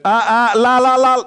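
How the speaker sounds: mock la la la, not listening; childish la la la. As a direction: drown her out like a kid with fingers in ears. Plugging ears, refusing.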